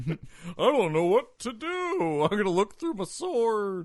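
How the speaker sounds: a bumbling hero in a high Texas twang; dopey Lion-O Texas accent. Pretend. Mocking cartoon Lion-O in a silly Texas accent. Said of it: "why Lion-O has a Texas accent".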